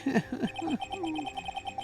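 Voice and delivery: high cackling villain laugh; evil high laugh. Acted. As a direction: cackle with glee.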